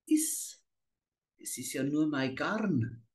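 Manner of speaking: squeaky high then soft sly witch. A narrator's reading. Witch dismisses the girl's fear, sly. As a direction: toss off the lie slyly.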